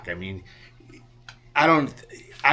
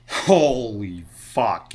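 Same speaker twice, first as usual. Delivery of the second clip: drawn-out, slow "holy fuck" of dismay; retold stunned disbelief; 3 semitones lower, much slower than usual. Drinking with John after burning the car, stunned disbelief. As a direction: stretch it out as if stunned. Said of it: "I'm just like".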